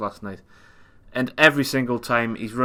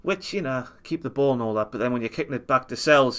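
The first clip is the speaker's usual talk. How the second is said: ordinary talk, nothing marked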